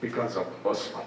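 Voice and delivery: deep solemn reply to the nosy lady; deep serious voice. Retold or imitated. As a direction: drop deep and dead serious. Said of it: "real serious with a deep voice".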